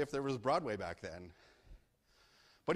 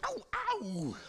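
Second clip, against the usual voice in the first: high squeaky ow ow; cartoon yelp of pain; mock yelp. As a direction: yelp like a kicked pup. Coyote kicked out.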